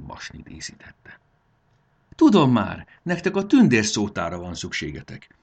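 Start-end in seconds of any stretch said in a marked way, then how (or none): 2.1-5.4 sudden exclamation. A narrator's reading. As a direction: blurt it out as an idea strikes. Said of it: "kiáltott fel". (hirtelen felkiáltás)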